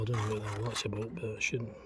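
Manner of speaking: ordinary talk, nothing marked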